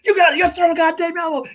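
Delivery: high-pitched mock coach tirade; Ben Affleck pissed off. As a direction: yell it like an imitated angry coach. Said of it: "Ben Affleck gets fucking pissed".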